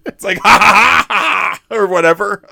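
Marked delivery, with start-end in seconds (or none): 0.4-1.8 loud, high staged cackle; imitated mad laugh. Imitating the hunter who just laughs, staged cackle. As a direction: fake a big maniacal laugh. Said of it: "He just laughs".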